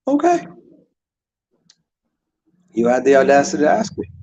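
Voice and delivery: mock indignant. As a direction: play the offended tough guy, lightly. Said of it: "he would be like".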